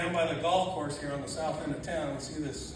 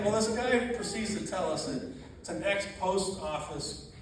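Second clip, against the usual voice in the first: ordinary delivery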